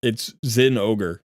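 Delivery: "Zin ogre" spelled out slowly, ZIN stressed, ogre apart; slow down and split "Zin ogre"; slow careful pronunciation. Shown as a demonstration. Settling the champion name for viewers, slow and deliberate. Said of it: "Why am I calling her Zenogre?".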